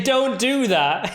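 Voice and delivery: high, slow mock scolding; mimicking the woman. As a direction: imitate a woman's drawn-out complaint. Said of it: "What did she say?".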